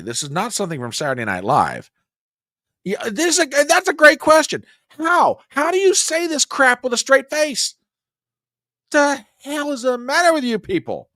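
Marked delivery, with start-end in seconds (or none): 2.8-11.1 exasperated disbelief. Real, not acted. Host mocks clip, exasperated.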